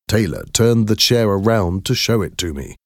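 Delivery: ordinary delivery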